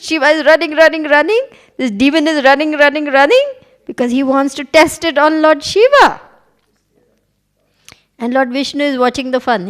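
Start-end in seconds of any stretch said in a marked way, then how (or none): 0.0-6.2 louder, breathless storytelling; excited chase narration. A narrator's reading. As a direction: tell the chase with rising excitement.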